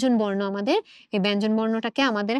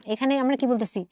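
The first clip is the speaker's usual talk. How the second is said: usual speaking voice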